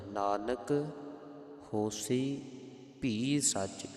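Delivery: Nanak, a short halt, then ਹੋਸੀ ਭੀ ਸੱਚ; slight pause. Shown as a demonstration. Teacher demonstrates the verse, brief pause after Nanak. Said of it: "ਥੋੜ੍ਹਾ ਜਿਹਾ ਰੁਕਣਾ ਹੈ".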